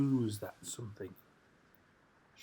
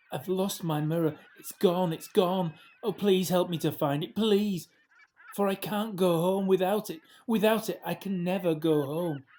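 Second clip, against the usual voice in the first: louder, higher, pleading in distress; distressed crying plea; 5 semitones higher, 5 dB louder than usual. Acted. Lost mermaid begs Eliza, tearful panic. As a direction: beg for help in tearful panic. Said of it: "Freya crying".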